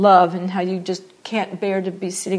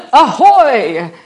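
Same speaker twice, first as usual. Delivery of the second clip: bellowed mock theatrical hail, much louder; hammy stage greeting; 3 semitones higher, 11 dB louder than usual. Shown as a demonstration. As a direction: throw it out like a bad Shakespearean actor. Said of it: "like a bad Shakespearean production".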